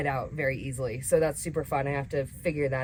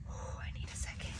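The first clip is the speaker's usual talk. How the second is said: sigh, then a bare whisper; barely audible, worn out; deflated whisper; 17 dB quieter than usual. Real, not acted. Deflated, needs a moment.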